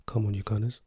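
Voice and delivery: usual speaking voice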